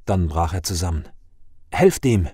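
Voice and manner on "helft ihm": brief urgent call, a little louder. A narrator's reading. Forest faun to the tavern for the collapsed farmer, calling out. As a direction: call out for help. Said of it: "rief Tarkan der Waldfaun".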